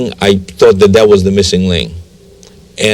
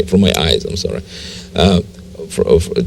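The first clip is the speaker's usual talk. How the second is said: choked up; 4 semitones lower, 6 dB quieter than usual. Real, not acted. Breaks down recalling his conversion.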